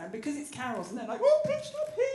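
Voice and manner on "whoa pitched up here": much higher and louder squeal; funny lady voice. Retold or imitated. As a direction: squeal it way up high. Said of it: "sing in your funny kind of lady voice".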